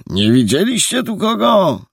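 put-on a policeman calling out to an old man; the police commander calling to the old watchman; gruff commander calling. A narrator's reading. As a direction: call across the courtyard.

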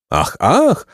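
high, astonished gasps of "ach"; high-voiced wonder; amazed maidens. A narrator's reading. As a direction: gasp in storybook amazement. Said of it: "zdumiały się dziewice".

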